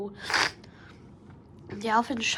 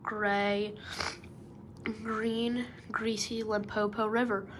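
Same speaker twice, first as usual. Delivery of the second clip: usual speaking voice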